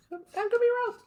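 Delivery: tiny, very high soft voice; high-pitched coy hedge; joking squeak. Real, not acted. Repeats own remark as joke.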